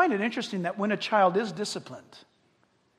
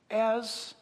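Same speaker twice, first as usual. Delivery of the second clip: usual speaking voice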